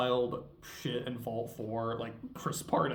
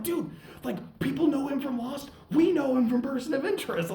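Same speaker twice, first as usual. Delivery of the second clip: higher, excited fanboy gush; imitating excited friends; 11 semitones higher, 4 dB louder, faster than usual. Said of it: "that made them really excited".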